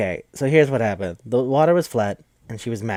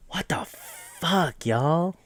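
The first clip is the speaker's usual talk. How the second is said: disbelief; 4 semitones higher, 4 dB quieter, much slower than usual. Real, not acted. Reacting to a twist in the movie.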